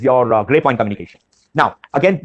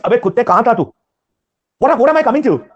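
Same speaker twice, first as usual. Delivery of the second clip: casual banter with friends; 6 semitones higher, faster than usual. Retold or imitated. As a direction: tease a buddy who vanished on you. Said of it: "When you are with your friends".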